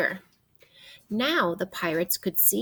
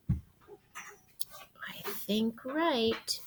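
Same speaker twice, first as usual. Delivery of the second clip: soft unvoiced whisper, slow; 3 semitones higher, 7 dB quieter, much slower than usual. Whispering a guess to the listening child. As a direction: breathe the answer like a secret. Kid's answer whispered aside.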